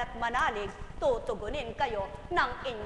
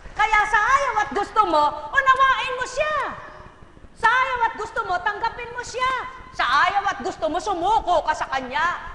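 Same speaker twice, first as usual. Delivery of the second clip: loud, higher, fast repeated command; fiery exhortation; 7 semitones higher, 9 dB louder than usual. Real, not acted. Preacher urges listeners to surrender, fiery and loud. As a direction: drive it home, building.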